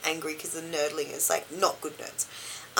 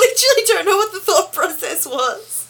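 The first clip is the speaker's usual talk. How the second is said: laughing; 12 semitones higher, 8 dB louder than usual. Real, not acted. Laughing through the line.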